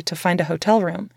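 usual speaking voice

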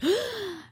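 sharp high shocked gasp. Retold or imitated. The store crowd gasps in shock at her, a sharp high gasp. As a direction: gasp in mock horror like a whole crowd. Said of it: "everybody in the store would gasp".

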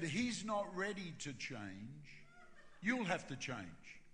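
slow, even, deliberate delivery; measured pronouncement. Retold or imitated. Holy Spirit speaking to the pastor, slow and measured. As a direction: tell it slowly and patiently.

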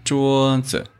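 桌子 with a high level 桌 and a dropped, light 子; neutral tone demo. Shown as a demonstration. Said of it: "So it should be 桌子".

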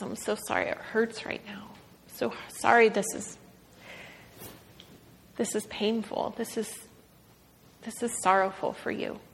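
put-on whispered and hushed, like consoling a hurt child; a parent whispering comfort, no voice on the first line; tender whispered comfort. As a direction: breathe the sorry to a hurting child. God voiced comforting a hurting child.

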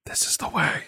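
breathy whisper from the couch. Pretend. Couch answers the host in a whisper. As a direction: whisper it like a secret oracle. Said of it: "Hold on, whispers".

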